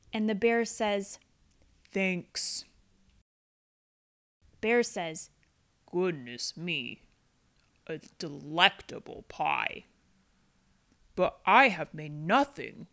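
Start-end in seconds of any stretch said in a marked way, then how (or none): 1.9-2.7 low, soft gentle bear; a big shy bear mumbling thanks. Acted. Bear thanking Mouse for the pie, low and soft. Bear's voice. 5.9-12.9 a big shy bear mumbling thanks; low bear voice. Acted. Bear's voice.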